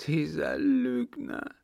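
high, slow tearful accusation; drawn-out high mock-tearful voice; acted sobbing. A narrator's reading. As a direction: wail it slowly as a wronged girl. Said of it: "schluchzte die Gefragte".

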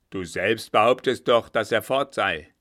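loud, forceful rebuke; angry reproach. Acted. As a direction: throw his own claim back at him. Captain reproaches coachman. (lauter, ärgerlicher Vorwurf)

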